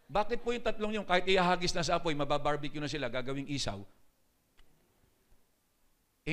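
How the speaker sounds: ordinary talk, nothing marked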